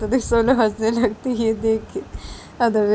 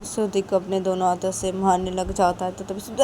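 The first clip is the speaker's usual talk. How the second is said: plain reading voice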